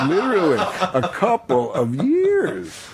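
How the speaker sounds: high, slow laughing. Real, not acted. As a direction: laugh it out slowly. Laughing at himself.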